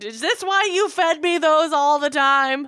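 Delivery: high, loud, drawn-out accusation; mock outraged confrontation. Daughter confronting mom about childhood cornflakes, mock outraged. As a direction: wail it accusingly at your mom.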